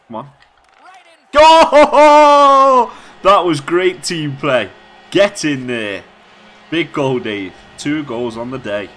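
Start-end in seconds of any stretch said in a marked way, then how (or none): 1.3-2.9 huge high scream of joy with a laugh; goal celebration scream. Real, not acted. 3.2-8.9 loud, slow, elated praise; buzzing with joy, much louder; excited celebration. Real, not acted. Celebrating goal.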